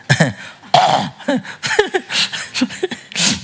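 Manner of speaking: a long, high, real laugh at his own joke; amused laugh. Real, not acted. Teacher laughing at his chapati joke, high amused laugh. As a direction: let the laugh ride up high and roll out.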